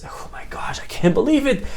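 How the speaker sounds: breathless whisper of disbelief; hushed, stunned, barely voiced; awed whisper. Cured blind husband whispers in awe at his sight, whispered.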